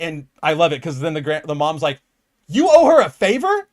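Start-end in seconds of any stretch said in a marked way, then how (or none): 2.5-3.7 much higher, louder disbelieving question; a mother stunned and scolding; mock outraged grandma; incredulous mom. Grandma scolding her son.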